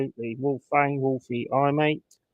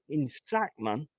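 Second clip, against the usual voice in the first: ordinary talk, nothing marked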